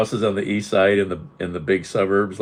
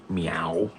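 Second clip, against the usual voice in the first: meow; 3 dB quieter than usual. Pretend. Imitates the cat.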